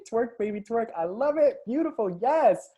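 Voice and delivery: high, loud cheering over the clip; excited hype. Real, not acted. Coach cheering a client's twerk video, excited hype. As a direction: egg the dancer on.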